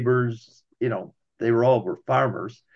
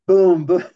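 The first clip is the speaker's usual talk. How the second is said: loud punchy boom boom, a retold shotgun blast; mock gunshots; 5 semitones higher, 8 dB louder than usual. Retelling a first deer hunt, loud mock gunfire. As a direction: belt out the shots like firing the gun.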